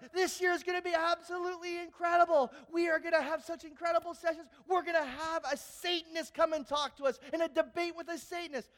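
student hyping the group; hyped shout. Retold or imitated. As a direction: sell it with full energy. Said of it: "I said".

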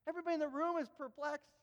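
usual speaking voice

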